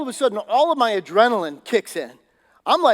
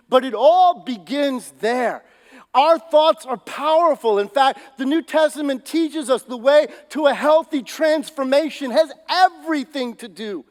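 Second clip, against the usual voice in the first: slow, louder pulpit delivery, each phrase hammered home; full-force preaching; 4 semitones higher, 4 dB louder than usual. Acted. Pastor preaching to his church, slow and forceful. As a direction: drive the point home to the congregation.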